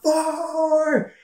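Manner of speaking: high, drawn-out "fore" call; mock golf warning yell. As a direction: imitate calling out across the course. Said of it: "And we yelled out".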